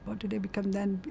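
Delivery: usual speaking voice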